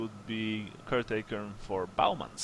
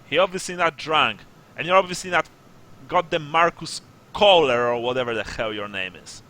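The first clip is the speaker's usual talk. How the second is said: emphatic, raised-voice dressing-down; exasperated sergeant; 5 semitones higher, 11 dB louder, faster than usual. Acted. Sergeant calls out the drunk story as false, exasperated. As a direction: berate them, disbelieving and fed up.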